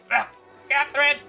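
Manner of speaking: ordinary delivery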